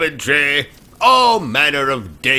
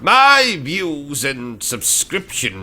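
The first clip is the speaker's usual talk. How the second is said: put-on British accent; 4 semitones higher than usual.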